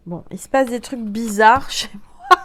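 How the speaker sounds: amused laugh mid-line. Real, not acted. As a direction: giggle at the strange happening. Laughing at card appearing.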